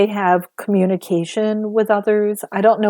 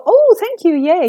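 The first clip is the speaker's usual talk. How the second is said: high, louder, gushing imitation of gratitude; mock delighted thanks; 11 semitones higher, 6 dB louder than usual. Stranger gratefully receiving a message, mock delight. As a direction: act out an overjoyed "thank you" in a raised pitch.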